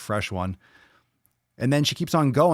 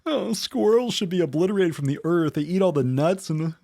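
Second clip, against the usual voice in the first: higher, hammed-up voice of an offended critic; mock outraged snowflake; 7 semitones higher than usual. Mocking triggered commenters to viewers, hammed-up outrage. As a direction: lampoon them as hysterical, breaking into a laugh.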